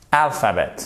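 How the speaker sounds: alphabet with no H, AL stressed, falling; first-syllable stress. Shown as a demonstration. Teacher repeating the correct word, stress on first syllable. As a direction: punch AL in "alphabet". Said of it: "c'est alphabet".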